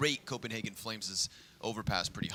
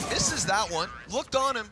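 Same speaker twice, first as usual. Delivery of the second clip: high, loud hyped casting; excited; 9 semitones higher, 5 dB louder than usual. Real, not acted. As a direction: call the duel with rising hype. Casters hyped over a close duel.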